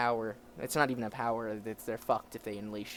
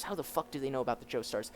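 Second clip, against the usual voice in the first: plain reading voice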